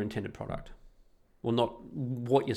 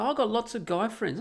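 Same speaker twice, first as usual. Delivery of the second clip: imitating girls; 10 semitones higher than usual. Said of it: "the girls go".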